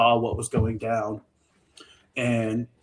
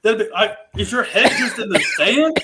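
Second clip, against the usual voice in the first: louder, higher, fed-up outburst; venting at Beth for refusing the gun; exasperated rant; 7 semitones higher, 8 dB louder than usual. Retold or imitated. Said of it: "It's like".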